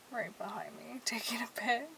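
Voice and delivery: whispered aside; hushed whisper about a stranger. Real, not acted. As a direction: whisper so he won't hear. Guy behind her.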